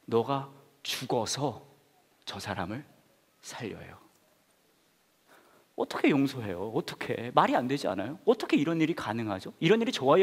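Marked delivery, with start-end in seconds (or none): 0.0-4.0 slow, fading softer to a near hush; 천천히 낮게 속삭이듯; slow dramatic hush. Shown as a demonstration. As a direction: let it land slowly and quietly. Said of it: "준비하고 들으세요".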